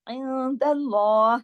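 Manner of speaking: slow इंदल्लाह with the nun hidden nasally; tajweed recitation. Shown as a demonstration. Teacher demonstrating ikhfa to students, slow chant. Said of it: "नून के ऊपर".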